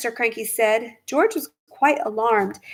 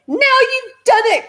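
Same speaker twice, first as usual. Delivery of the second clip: much higher, louder accusation; angry cry; 5 dB louder than usual. A narrator's reading. Wife blaming her husband, angry cry. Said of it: "cried Missus Cranky".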